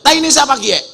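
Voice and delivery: high, loud mock shout; loud high-pitched Ngapak holler; indignant Ngapak yell. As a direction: imitate a Ngapak villager yelling a question. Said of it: "Orang ngapak teriak".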